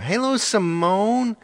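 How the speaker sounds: high, loud, drawn-out hello; excited greeting. Real, not acted. Greeting a viewer from Ireland, excited high call. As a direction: call out slowly and high in delight.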